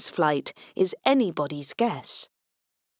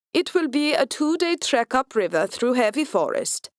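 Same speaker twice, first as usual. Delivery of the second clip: put-on German officer with a Swedish accent; 5 semitones higher than usual. A narrator's reading. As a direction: stiff Swedish-accented commanding voice. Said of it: "in his incongruous Swedish accent".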